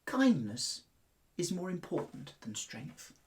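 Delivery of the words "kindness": usual speaking voice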